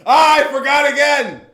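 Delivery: high, loud hammed-up yelp of forgetting; mock dismay. Co-host reminded to answer a DM, hammed-up high yelp. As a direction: cry out in fake shock.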